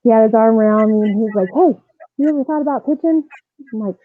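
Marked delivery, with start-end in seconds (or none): 1.5-3.2 coach asking. Retold or imitated. Coach voiced in story.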